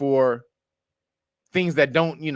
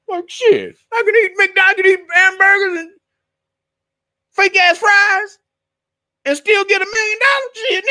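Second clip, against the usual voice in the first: mocking freeloader; 9 dB louder than usual. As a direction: shriek it like a gloating freeloader who gets paid for nothing.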